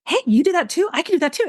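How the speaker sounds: high, bubbly mock chatter; excited girlfriends. New friends on set comparing talents, high and giddy. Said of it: "we're like".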